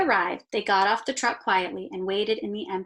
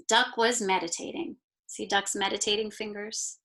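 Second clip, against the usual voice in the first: ordinary delivery, nothing marked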